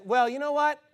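spiteful. Retold or imitated. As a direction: throw down a grudging dare. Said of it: "being spiteful".

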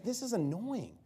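acted-out peeved questioning; annoyed. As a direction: voice God as wearily irritated. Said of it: "God's just like".

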